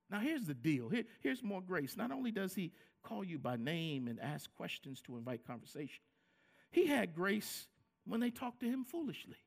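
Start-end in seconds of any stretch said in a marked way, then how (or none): none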